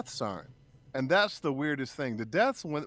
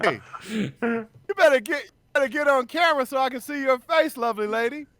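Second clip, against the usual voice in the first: playful calling out; 10 semitones higher, 7 dB louder than usual. Real, not acted. Teasingly calls wife onto camera.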